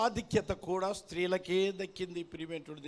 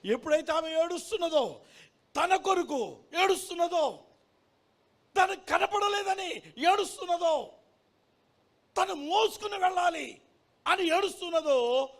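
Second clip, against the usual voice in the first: high, ringing cry in short phrases; impassioned preaching; 11 semitones higher, 7 dB louder than usual. Acted. Preacher recounting a woman weeping for Jesus, impassioned preaching. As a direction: build the story with insistent raised phrases.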